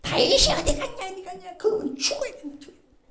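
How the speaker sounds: high-pitched furious king Saul; a furious king shouting for David; enraged king. Acted. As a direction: rage like a king whose guest fled. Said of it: "노발대발이죠".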